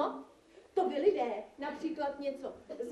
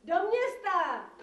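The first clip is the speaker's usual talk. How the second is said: higher, slow call to the driver; 4 semitones higher, 4 dB louder, slower than usual. Acted. Devil hitchhiker answers the magistrate, drawn-out call. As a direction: stretch it out as a call.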